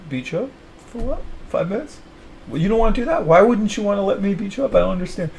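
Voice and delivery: high mock-baffled coaxing; mock innocent whine. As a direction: play a wide-eyed pest begging for a match.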